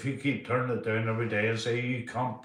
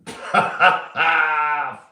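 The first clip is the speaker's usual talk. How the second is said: short, high burst of laughter; real loud laugh; 5 semitones higher, 10 dB louder than usual. Real, not acted. Laughs at his own story.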